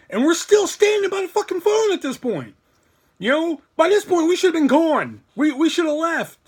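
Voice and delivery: loud, high-pitched exasperated outburst. Real, not acted. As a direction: raise your voice high, exasperated at the mistake. Exasperated at their own staying put.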